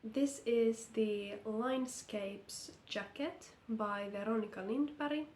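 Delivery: ordinary talk, nothing marked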